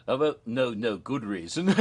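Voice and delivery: ordinary talk, nothing marked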